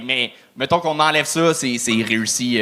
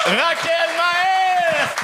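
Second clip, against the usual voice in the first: long drawn-out shout of the name; announcer call; much slower than usual. Real, not acted. Host announces next comic.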